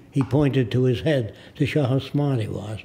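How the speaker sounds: ordinary delivery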